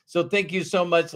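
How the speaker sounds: normal talking voice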